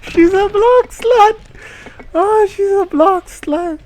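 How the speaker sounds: very high, loud, slow scandalised squeal; hammed-up scandalised shout; shocked shriek. Real, not acted. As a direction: drag it out in gleeful outrage. Streamer shocked by story turn.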